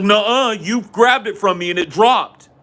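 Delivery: put-on high-pitched kid arguing back; childish denial. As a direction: throw the blame back like a child. Retold child denying and accusing back.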